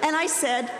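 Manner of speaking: ordinary talk, nothing marked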